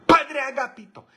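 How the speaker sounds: high, loud falsetto maid calling the priest; high-pitched mock maid voice; maid's shrill call. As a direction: squeal his name like a fussy old maid. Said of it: "le decía".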